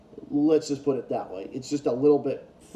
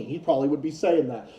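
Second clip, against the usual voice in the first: usual speaking voice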